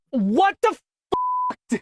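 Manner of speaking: high, drawn-out cry of real frustration; exasperated outburst. Real, not acted. Narrator after flubbing a word, exasperated outburst. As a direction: let it burst out high and slow.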